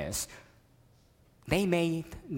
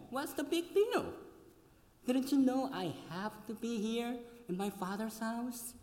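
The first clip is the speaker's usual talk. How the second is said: higher, lightly voiced innocent boy; innocent young Jesus; 8 semitones higher, 6 dB quieter than usual. Boy Jesus answers his parents, mildly innocent and higher. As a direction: shrug it off like a child. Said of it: "he might have said".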